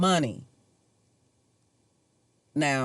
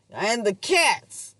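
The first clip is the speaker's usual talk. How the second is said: «And the cats» said slowly and higher, drawn out; imitating the landlady; 5 semitones higher, slower than usual. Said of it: "I think that's how she said it".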